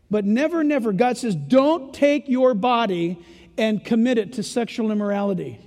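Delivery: ordinary delivery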